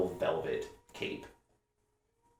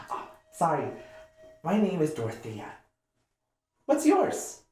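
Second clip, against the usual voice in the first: put-on high, bright girlish voice; young half-orc girl; 12 semitones higher, 6 dB louder than usual. Dorothea coaxing the hiding player out, high girlish voice. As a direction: coax them out like an eager kid.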